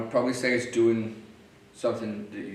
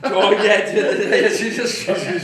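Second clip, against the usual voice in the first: high, loud laughing; 11 semitones higher, 11 dB louder, slower than usual. Real, not acted. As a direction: laugh out the words. Laughing at the story.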